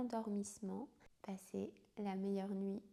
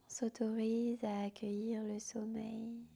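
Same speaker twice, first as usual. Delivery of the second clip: guided sleep meditation. Acted. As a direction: whisper them gently down into sleep. Yoga nidra guided to sleep.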